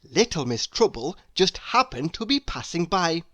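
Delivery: ordinary delivery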